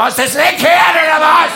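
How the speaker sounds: loud, high bark; shouted high, bossy rebuke; shouting voice. Retold or imitated. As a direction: yell it like a bossy voice in your head. Inner voice barks at him.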